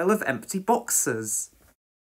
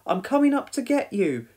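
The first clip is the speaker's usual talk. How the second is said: high, pitched-up reassuring call; 8 semitones higher than usual. A narrator's reading. Firefighter about to climb the ladder, calling up. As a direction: call up from below. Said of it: "called Penny".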